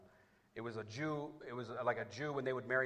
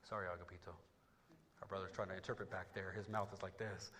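normal talking voice

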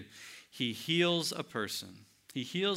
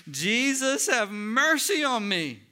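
an even more insistent loud plea, much higher; crying out louder; 9 semitones higher, 6 dB louder, slower than usual. Retold or imitated. Beggar raising his cry to Jesus despite the crowd, louder and pleading. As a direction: push the cry harder, over the crowd. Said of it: "he kept saying it louder".

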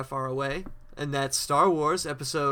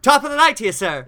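high, loud, hammed-up gentleman voice; old-timey gentleman; 11 semitones higher, 10 dB louder than usual. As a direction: ham up a Victorian gent greeting. Answers the joke in character.

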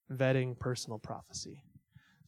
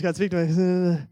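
slow, higher, loud mock prayer; hammed-up childhood plea; 5 semitones higher, 10 dB louder, slower than usual. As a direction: drag out a comically strained plea to God. Replaying the kid's second Bible attempt.

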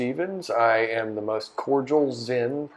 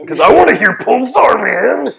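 wrestling hype; 11 semitones higher, 11 dB louder than usual. Acted.

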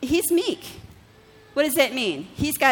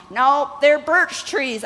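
higher, louder, slow insistent contradiction; stubborn argument; 5 semitones higher, 6 dB louder, slower than usual. Retold or imitated. Wife insisting to her husband they are birch trees, slow and loud. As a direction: dig in and insist. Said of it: "I would have argued".

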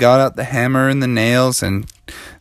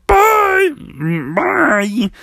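farewell in character. Acted. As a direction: call bye as two puppet characters. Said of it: "Kermie and Piggy said".

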